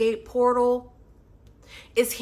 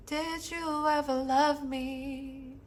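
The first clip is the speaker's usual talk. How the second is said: higher and soft, slow and aching; soft heartbroken plea; 4 semitones higher, 6 dB quieter, much slower than usual. Acted. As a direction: ask slowly, as if afraid of the answer. Recites song's heartbroken words.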